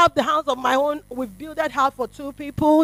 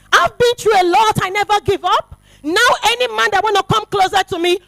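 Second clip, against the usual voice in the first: loud, raised testimony; preaching; 3 semitones higher, 4 dB louder than usual. Acted. Preacher warning men off before the congregation, loud and high. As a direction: declare it proudly to the crowd.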